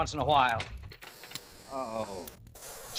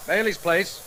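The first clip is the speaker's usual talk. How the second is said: phone answer; 6 semitones higher, 6 dB louder, slower than usual. Acted.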